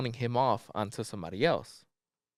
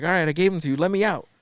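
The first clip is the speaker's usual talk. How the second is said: loud, higher retold demand; angry demand; 7 semitones higher, 8 dB louder, faster than usual. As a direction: insist he open the door now. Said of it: "She's like".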